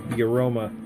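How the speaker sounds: normal talking voice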